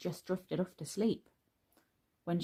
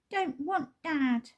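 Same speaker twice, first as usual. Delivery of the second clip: put-on much higher, slow toddler protest; bellowing toddler; 9 semitones higher, slower than usual. A narrator's reading. Baby refusing her dad, high slow bellow. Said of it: "bellowed the baby".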